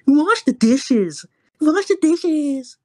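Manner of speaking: high, drawn-out mom voice; slow, high mocking mom call; mock nagging mom. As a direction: stretch it out high like a nagging mother. Acting out his yelling mother.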